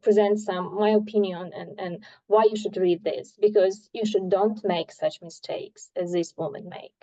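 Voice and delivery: normal talking voice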